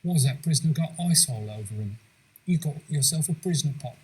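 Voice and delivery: put-on Cockney-style British accent; Michael Caine impression. Friend as Dickens joking about the iced prisoner, British accent. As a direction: crack the joke in an English accent. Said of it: "If I use Kane as my touchstone".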